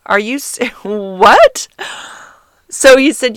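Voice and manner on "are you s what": stunned disbelief. Retold or imitated. Stunned by friend-zone speech.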